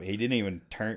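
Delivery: ordinary talk, nothing marked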